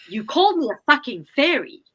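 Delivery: mock furious retort at her friend; firecracker outburst. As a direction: snap it out like a firecracker going off. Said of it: "like a firecracker".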